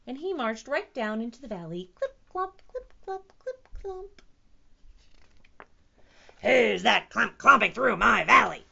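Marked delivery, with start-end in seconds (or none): 2.0-4.2 high, tiny evenly spaced steps; little footsteps. A narrator's reading. Stanley marches into the valley, tiny high footsteps. 6.4-8.6 loud, booming monster challenge; T-Rex roar. A narrator's reading. T-Rex challenges whoever crosses his valley, roaring. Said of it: "roared the T-Rex".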